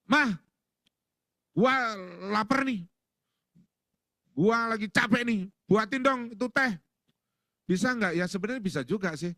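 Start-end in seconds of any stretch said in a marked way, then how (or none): 0.1-6.7 high, raised-voice husband demand; impatient shouted order, acted; demanding yell. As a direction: call out bluntly to your wife. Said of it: "Bapaknya teriak".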